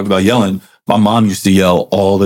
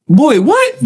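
high, surprised mom voice; mom's startled reply. Retold or imitated. Son retelling his mom's reply, high and startled. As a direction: play the mom caught off guard. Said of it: "she looked at me like".

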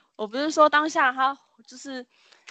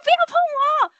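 shrill, much higher frantic shriek; frenzied scream; 4 dB louder than usual. Retold or imitated. Possessed boy thrashing as classmates grab him, frantic scream. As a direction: scream like you've lost your mind. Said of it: "这时候他就开始发疯咯".

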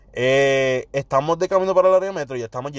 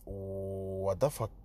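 confundido total; 5 semitones lower, 13 dB quieter, much slower than usual. Retold or imitated. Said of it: "Y mi cara como que".

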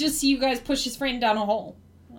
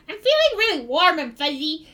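higher-pitched dopey goblin voice; dazed goblin; 4 semitones higher than usual. Acted. Goblin says how he feels, higher dazed goblin voice. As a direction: goblin basking in a new feeling. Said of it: "he was like".

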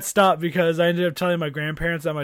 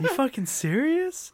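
higher, slower, incredulous rise; mock disbelief; 9 semitones higher, slower than usual. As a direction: act stunned at what you just heard. Retelling Rogan's shocked reaction.

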